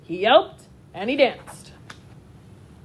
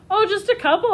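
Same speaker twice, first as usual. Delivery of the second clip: coy understatement; 10 semitones higher than usual. A narrator's reading. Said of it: "Oscar repeated".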